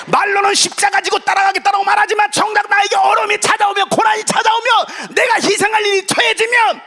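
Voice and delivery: high, loud, driving sermon shout; full-force preaching. Real, not acted. Preacher challenging the congregation's commitment, high impassioned shouting. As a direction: preach it at the top of your voice.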